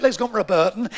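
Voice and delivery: ordinary talk, nothing marked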